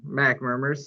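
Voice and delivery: usual speaking voice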